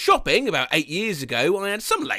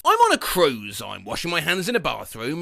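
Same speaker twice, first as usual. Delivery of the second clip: usual speaking voice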